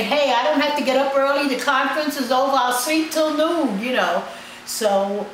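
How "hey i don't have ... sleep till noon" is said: higher-pitched carefree quote; imitating carefree people. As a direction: lightly mimic people happy to sleep in. Imitates people happy to lie in.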